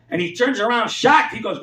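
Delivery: usual speaking voice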